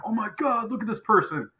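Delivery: high, rushed, awestruck gushing; excited gushing. Retold or imitated. Staff gushing over a newcomer at the door, high excited gush. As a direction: gush like you've just seen a star walk in. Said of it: "they're like".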